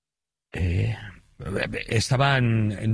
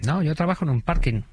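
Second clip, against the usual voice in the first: lightly voiced amateur magician, higher pitch; mago aficionado; 6 semitones higher than usual. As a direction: play a modest amateur magician, a bit higher. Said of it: "yo recuerdo un mago". (voz ligera de mago aficionado)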